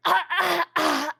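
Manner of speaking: high, loud gnome warming up; a gnome about to recite, high and loud; gnome clearing throat. Acted. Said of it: "the gnome goes".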